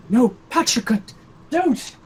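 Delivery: high, acted frightened plea; scared pleading. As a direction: play him freaked out, pitch jumping up. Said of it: "He's also pretty scared".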